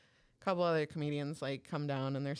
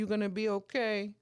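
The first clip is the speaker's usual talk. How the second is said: higher-pitched teasing, retold; mock concern; 5 semitones higher, 3 dB louder than usual. Dudes at the bar roasting a comedian, mock concern. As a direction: roast him with fake concern. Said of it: "start kinda roasting this dude".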